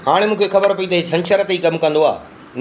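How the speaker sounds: usual speaking voice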